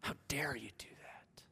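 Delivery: a hushed, sulky whisper; a touch of a sulking prophet, breathy and hushed; sulking Jonah. Acted. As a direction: whisper it like a pouting child. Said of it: "he's in one giant, childish pout".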